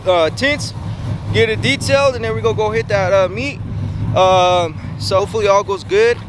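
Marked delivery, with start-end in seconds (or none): none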